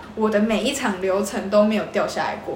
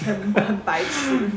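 laughing; 4 semitones lower, 4 dB quieter, much slower than usual. Real, not acted. Teasing co-host, slow laughing.

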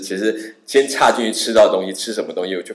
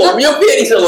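high, louder protest through a laugh; flustered denial; 6 dB louder than usual. Retold or imitated. Traveller protests to the girl, laughing and flustered.

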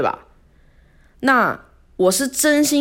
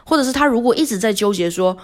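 normal talking voice

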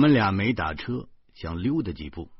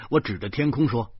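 ordinary delivery

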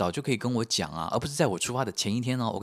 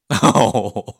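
loud nervous laugh; 2 semitones higher, 13 dB louder than usual. Real, not acted.

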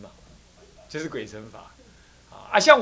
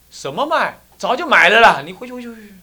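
impatient dismissal; 7 semitones higher, 10 dB louder than usual. Acted. Old man sends him away.

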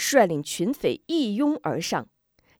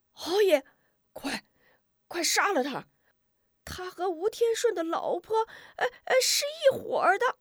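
very high, frantic urging; a coward egging on the killers in panic; frantic yelling; 12 semitones higher than usual. Acted. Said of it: "急得直嚷嚷".

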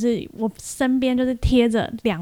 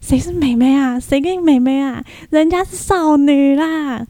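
pitched-up cutesy protest, slowing at the end; mock indignant; 4 semitones higher than usual. Narrator retorts to the cameraman in her head: coy mock indignation. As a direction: play hurt and coy, insisting on being a girl. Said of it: "然后心里想说".

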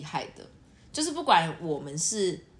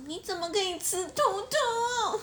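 put-on much higher, slow, whiny baby talk on 兔兔; cutesy girl voice; slower than usual. Cutesy girl whining about eating bunnies, baby voice. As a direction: act cute and pouty over 兔兔. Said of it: "有些女生很喜欢装可爱".

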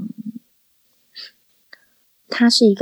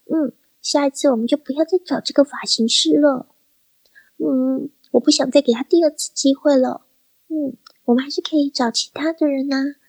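high, sweet celebrity whimper over a pulled hair; Lin Chi-ling voice; 7 semitones higher than usual. Retold or imitated. Imitating Lin Chi-ling hurt by a hairdresser, high sweet voice. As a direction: play the dainty star saying ouch in a much higher voice. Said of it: "如果林志玲讲话这个样子".

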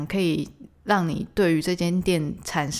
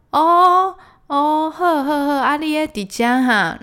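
loud high "哦" of dawning recognition; the owner finally getting it; sudden realization; 9 semitones higher, 7 dB louder, slower than usual. Retold or imitated.

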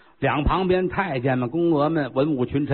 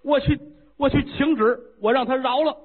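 put-on high, fiery young voice; a hot-headed prince vowing to act; eager young prince; 9 semitones higher than usual. Prince insists he will plead.

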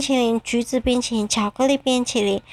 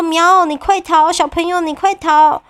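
high, loud urgent cat voice; a little cat urging the child to run, higher and louder; urgent kitten; 6 semitones higher, 4 dB louder than usual. Acted. 小猫催小朋友快逃. (小猫急促地喊，声音更高更响)

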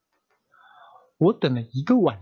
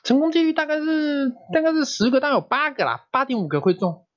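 much higher, coy voice acting out the pitch; performed on request; 11 semitones higher than usual. Host asks him to act it out with his voice, high and coy. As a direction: squeeze the odds out in a high coy voice. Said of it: "你现在用声音就表一次".

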